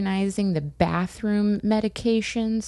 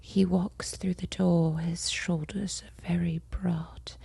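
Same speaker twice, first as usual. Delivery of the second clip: mock audiobook whisper; 6 dB quieter than usual. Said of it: "then she's like".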